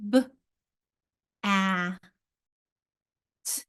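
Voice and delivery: "b, a, t" as isolated letter sounds, long pauses. Shown as a demonstration. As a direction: sound out "b, a, t" one phoneme at a time. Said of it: "sound by sound".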